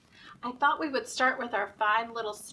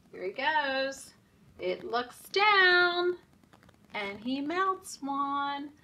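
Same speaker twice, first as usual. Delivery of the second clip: rhythmic nursery rhyme chant, slow on the sun; sing-song rhyme; 3 semitones higher, 5 dB louder, slower than usual. Acted. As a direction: chant the rhyme for little kids. Reading rhyme at story time.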